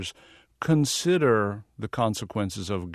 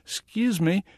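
put-on a folksy Southern accent, pitched up and sweet-talking the guard; 8 semitones higher, 4 dB louder than usual. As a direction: play up a friendly Southern accent to fit in. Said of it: "in my best Southern accent".

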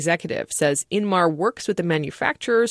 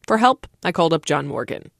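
ordinary talk, nothing marked

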